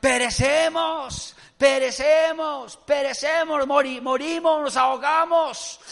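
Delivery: high, loud drawn-out cries; panicked shouting. Acted. Disciples screaming to Jesus in the storm, panicked shouting. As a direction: cry out as if the boat is going under. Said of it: "comenzaron a gritar".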